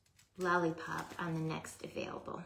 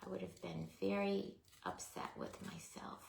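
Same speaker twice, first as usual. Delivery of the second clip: whispering; 7 dB quieter than usual. Real, not acted. Repeated whispered.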